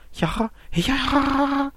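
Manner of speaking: very high, slow, strange giggling cackle; manic laughter. Acted. Soaked girl bursts into high eerie laughter at the man. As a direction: laugh like something unhinged. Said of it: "みたいに笑い出す".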